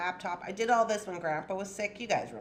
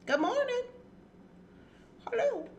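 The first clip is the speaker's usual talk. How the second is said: much higher sing-song hello; sweet pet greeting; 13 semitones higher than usual. Real, not acted. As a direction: coo a greeting to the animal.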